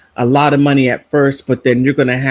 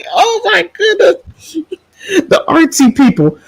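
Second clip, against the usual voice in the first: high, squeaky words through laughter; laughing till near tears. Real, not acted. Vlogger recalling the artsy people, helplessly laughing. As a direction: squeeze the words out while cracking up. Still cracking up at joke.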